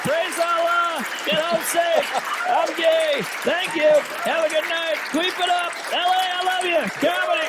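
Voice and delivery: squeaky hammed-up goodbye; goofy outro voice. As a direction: comedian mock-thanking the crowd in a silly high voice. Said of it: "It's a hell of an outro".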